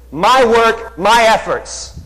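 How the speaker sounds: high, loud, arrogant retort; proud boast. Retold or imitated. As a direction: throw it back like a cocky teenager who credits only himself. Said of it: "Kevin says".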